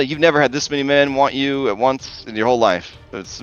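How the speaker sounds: usual speaking voice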